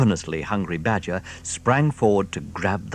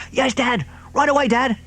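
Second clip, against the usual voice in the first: high, eager little foxes; eager cubs; 5 dB louder than usual. Acted. The small foxes to their father, high eager reply. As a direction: answer keenly.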